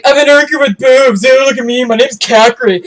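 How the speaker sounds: loud, high, sneering voice of a mean commenter; sneering mock imitation ending in a laugh; mocking the haters. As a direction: parody the bullies with a nasty, jeering laugh at the end. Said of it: "And they're all like".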